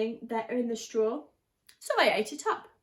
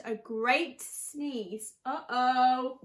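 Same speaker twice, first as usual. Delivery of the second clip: ordinary delivery, nothing marked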